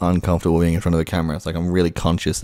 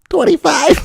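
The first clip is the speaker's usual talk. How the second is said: much higher, louder cartoon sponge; SpongeBob voice; 6 dB louder than usual. Shown as a demonstration. SpongeBob impression for the video, high and loud. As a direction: squeak it out like SpongeBob. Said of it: "do SpongeBob".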